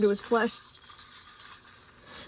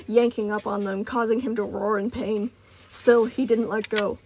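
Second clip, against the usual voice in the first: ordinary delivery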